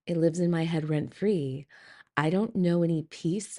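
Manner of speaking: ordinary talk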